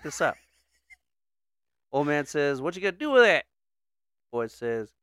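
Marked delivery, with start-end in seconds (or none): 2.6-3.5 put-on higher, louder Southern old man; Southern accent. Old man questioning the boy, Southern accent. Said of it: "why are they Southern?".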